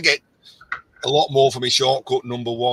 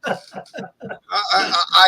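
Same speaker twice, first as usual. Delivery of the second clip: usual speaking voice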